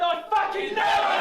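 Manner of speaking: shouted defiance; angry shout. Acted. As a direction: yell it at the cops. Character yelling at police.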